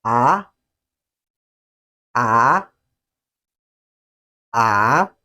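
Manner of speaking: AH gliding steadily upward; loud rising AH, like a question; second tone. Shown as a demonstration. Teacher models the second tone, rising AH. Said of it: "it goes this way".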